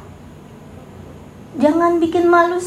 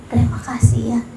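whispered thanks; 5 semitones lower than usual. Acted. Wife thanking her silent husband, whispered. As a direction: breathe the thanks out, voiceless. Closing the imagined wife's quote.

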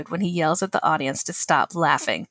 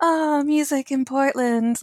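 dreamy; 7 semitones higher, slower than usual. Pretend. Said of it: "my hand under my chin".

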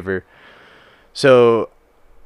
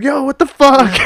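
much higher, louder outraged yell; angry yell; 9 dB louder, slower than usual. Retold or imitated. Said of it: "and he's like".

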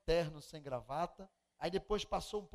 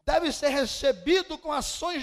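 fiery preaching; 7 semitones higher, 15 dB louder than usual. Acted. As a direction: thunder the verses out to the congregation. Preaching scripture at full force.

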